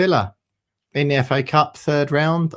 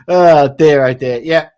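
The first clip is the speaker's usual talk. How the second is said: usual speaking voice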